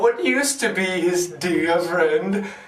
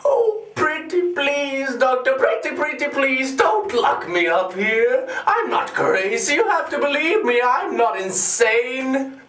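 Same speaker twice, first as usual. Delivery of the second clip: mock pleading; 3 semitones higher than usual. Madman begging the doctor not to lock him up, mock pleading. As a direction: beg theatrically, pretending to be sane.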